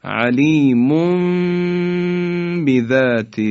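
علیمون بذات recited slowly, n into m; slow recitation. Shown as a demonstration. Teacher demonstrating علیمون بذات with iqlab. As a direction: let علیمون glide into بذات.

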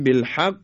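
"بالحق" with a longer hold on ق, then firm release; firmer qalqalah. Shown as a demonstration. Teacher contrasts doubled qaf in "بالحق", firmer qalqalah. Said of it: "محکمتر و با یک مکس".

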